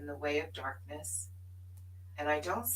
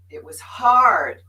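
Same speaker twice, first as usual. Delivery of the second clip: loud, slow stress on hard; slow, loud, weighty; emphatic; 4 semitones higher, 14 dB louder, slower than usual. Real, not acted. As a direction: lean hard on it. Emphatic admission.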